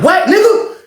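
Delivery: high, sharp comeback in an acted quarrel; high-pitched acted challenge; mock angry retort. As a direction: imitate a man snapping back, pitch way up. Acts out quarrel before fight.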